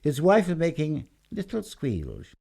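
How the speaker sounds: ordinary delivery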